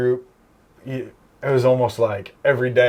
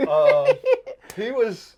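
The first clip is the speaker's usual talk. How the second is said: laughing; much slower than usual. Real, not acted. Laughing about coworker's lisp.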